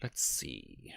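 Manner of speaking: soft unvoiced whisper; whispered aside. Real, not acted. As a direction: breathe it to yourself.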